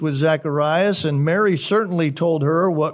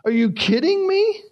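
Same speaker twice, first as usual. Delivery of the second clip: high mock disbelief; hammed-up incredulous question; shocked; 11 semitones higher than usual. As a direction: play the stunned relative, pitched up. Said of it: "Everybody's shocked about this".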